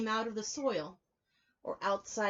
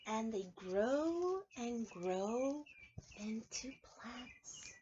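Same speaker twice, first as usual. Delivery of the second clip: slow drawn-out storytelling; 5 dB quieter, much slower than usual. Acted. Storytime growth, stretched words.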